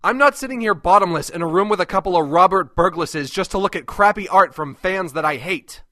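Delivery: normal talking voice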